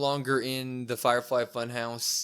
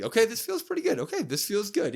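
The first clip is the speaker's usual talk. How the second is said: lightly hammed-up enjoyment, pitched up; mock pleasure; 11 semitones higher than usual. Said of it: "He starts saying it".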